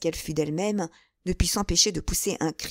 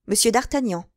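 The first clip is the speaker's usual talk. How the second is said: ordinary delivery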